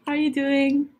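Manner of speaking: ordinary talk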